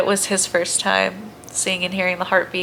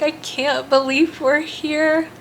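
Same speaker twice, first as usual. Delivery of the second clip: tearful retold awe; 7 semitones higher, slower than usual. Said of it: "I just start crying".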